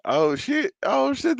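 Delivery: high delighted oh shit; surprised yelp. Real, not acted. Hearing his friend will try an accent, high surprised yelp. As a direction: jump up in pitch, caught off guard.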